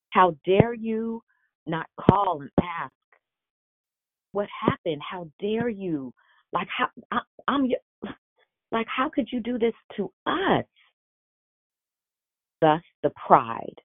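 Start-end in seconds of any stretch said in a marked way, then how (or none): 0.1-10.6 outraged. Retold or imitated. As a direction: stretch each word out in disbelieving anger. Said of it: "and now I'm mad".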